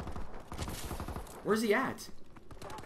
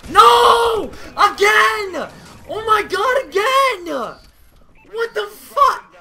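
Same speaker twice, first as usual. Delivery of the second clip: much higher, much louder screams, fading off at the end; furious real screaming; 13 semitones higher, 9 dB louder, much slower than usual. Real, not acted. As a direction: explode in frustration, then run out of steam. Loses match again, raging.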